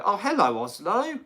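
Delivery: ordinary delivery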